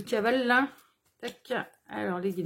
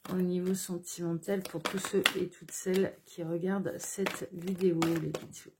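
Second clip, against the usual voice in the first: softer, lower, almost murmured request to the guides; hushed invocation; 5 semitones lower, 6 dB quieter than usual. Real, not acted. Tarot reader softly asking her guides to show the cards, hushed. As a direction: ask the spirit guides gently, under your breath.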